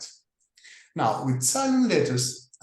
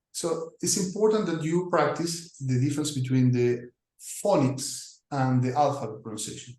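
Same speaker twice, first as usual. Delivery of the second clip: normal talking voice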